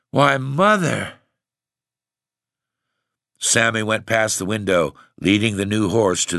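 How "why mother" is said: slow, dazed "Why, Mother." trailing off; dazed repeat. A narrator's reading. Husband repeating himself, slowly and dazed. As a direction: say it again, slowly and helplessly. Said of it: "the old man gasped".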